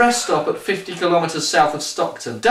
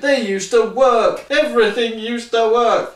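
put-on raised, higher complaint about the old days; old man shouting; 6 semitones higher than usual. A narrator's reading. Old man on the bus shouts his complaint, raised and high. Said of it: "he shouted".

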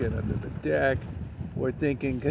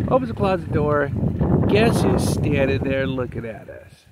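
ordinary talk, nothing marked

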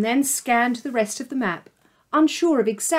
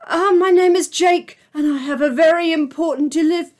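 nervous; 6 semitones higher, 6 dB louder than usual. Acted. Jake nervous on the phone.

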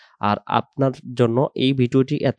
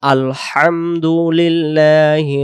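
whole verse chanted slow, louder; drawn-out recitation; 4 semitones higher, 5 dB louder, much slower than usual. Shown as a demonstration. Teacher reciting the verse twice, long and full. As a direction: sing the verse long in one breath. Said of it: "একসাথে টেনে পড়ব".

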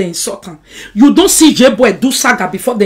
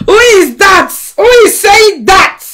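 high, loud challenge; indignant demand; 9 semitones higher, 6 dB louder, slower than usual. Real, not acted. Challenging whoever said that, loud and indignant. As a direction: call it out sharply, pitch leaping up. Angrily challenging someone on the live.

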